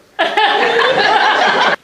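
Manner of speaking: high, loud laughing burst; burst of laughter. Real, not acted. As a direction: crack up at the punchline. Laughing at the punchline.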